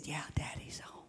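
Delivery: put-on hushed little boy whisper. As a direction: keep it unvoiced and secretive, like hiding. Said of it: "He whispered".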